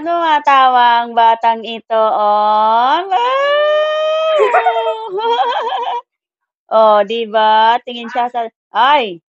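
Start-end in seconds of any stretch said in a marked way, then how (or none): 3.0-6.0 higher, squealing laugh of delight; real, higher burst of happy laughter; delighted shriek laugh. Real, not acted.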